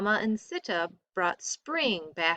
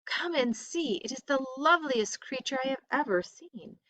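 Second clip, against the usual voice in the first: higher, eager call to Rama; delighted wife calling out; excited cry; 6 semitones higher than usual. A narrator's reading. Sita calls Rama to see the deer, excited. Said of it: "she cried".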